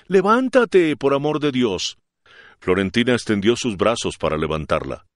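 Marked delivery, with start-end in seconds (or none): 0.1-2.0 higher and louder, pleading; urgent plea. A narrator's reading. The young lady begs the kneeling girl to rise, urgent and loud. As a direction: beg her to get up now.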